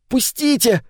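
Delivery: very high little squeak. A narrator's reading. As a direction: plead in a tiny high voice. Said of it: "пропищал Витя". (тоненький жалобный писк)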